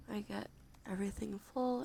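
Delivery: ordinary talk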